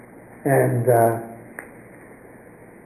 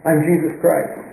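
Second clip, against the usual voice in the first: mild retold scream; 4 semitones higher than usual. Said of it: "And he screamed out".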